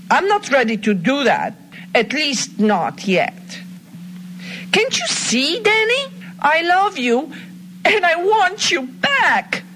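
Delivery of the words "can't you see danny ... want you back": pleading love. Acted. Hornet pleads her love to Danny.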